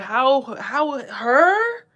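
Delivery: high, loud protesting voice; mock outrage, loud and high; mock jealous whining. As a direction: whine like a jealous rival, no, not her. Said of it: "They're like".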